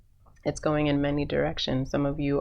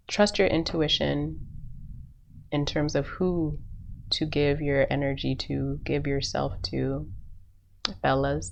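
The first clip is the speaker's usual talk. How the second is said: ordinary talk, nothing marked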